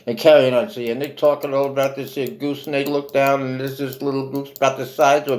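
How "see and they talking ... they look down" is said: ordinary delivery, nothing marked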